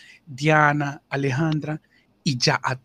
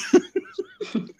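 high airy giggle; a quick unvoiced burst of laughter; breathy high laugh. Real, not acted. Narrator laughing at the manager's scolding, airy giggle.

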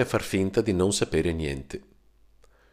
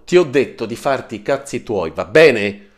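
angry snap; 4 semitones higher, 8 dB louder than usual. Acted. Marco snaps at his brother.

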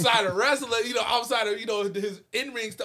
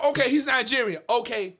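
usual speaking voice

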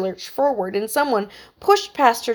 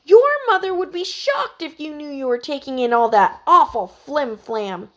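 higher, indignant scolding; stern rebuke; 6 semitones higher, 4 dB louder than usual. A narrator's reading. Emily scolds Heidi for watching junk: indignant rebuke. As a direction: scold her like a strict aunt. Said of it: "Emily's stern rebuke".

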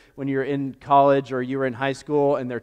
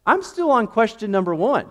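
flustered; 8 semitones higher, 6 dB louder than usual. Retold or imitated. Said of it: "I was just like".

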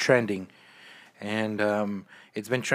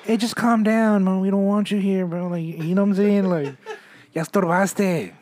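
calming a ghost; 7 semitones higher, 3 dB louder than usual. Pretend. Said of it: "You gotta tell him".

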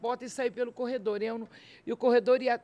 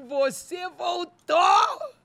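high, loud, very drawn-out exclamation; overjoyed cry; 7 semitones higher, 6 dB louder, much slower than usual. Retold or imitated. Turning round to God who returned, high overjoyed wail. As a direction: cry it out to God, stretching the last word. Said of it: "uma alegria e uma segurança absurda".

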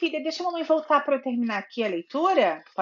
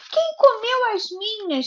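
higher, whiny little bear voice; whimpering baby bear; 9 semitones higher, 3 dB louder than usual. Acted. Mother voicing the baby bear for her daughter, whimpering. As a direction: whimper it like a small bear cub.

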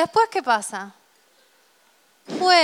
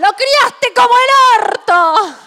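much louder, higher, slow; mock furious shout; 11 semitones higher, 8 dB louder, slower than usual. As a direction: scream it at the mother. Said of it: "Sabés qué". (grito furioso a la madre del novio, en broma)

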